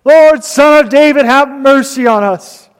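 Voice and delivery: loud, high-pitched imitated plea. Preacher retells blind men begging Jesus for mercy, loud cry. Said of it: "they cried out all the more".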